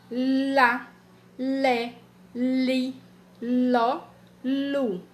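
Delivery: each syllable said slowly and apart: lá, lé, li, ló, lu; syllables spelled out. Shown as a demonstration. Teacher sounding out the syllables for children. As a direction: sound out lá, lé, li, ló, lu one by one for kids. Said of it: "Aqui eu tenho, ó".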